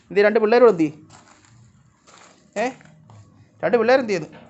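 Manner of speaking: high, loud shouting to the cats; calling out. Real, not acted. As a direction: shout across to the cats that food is coming. (ദൂരെയുള്ള പൂച്ചകളോട് ഉറക്കെ വിളിച്ചുപറയുന്നു)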